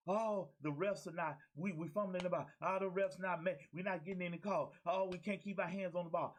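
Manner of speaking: high-pitched mock griping; mock complaining. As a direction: imitate players whining on the sideline. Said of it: "complaining Sunday".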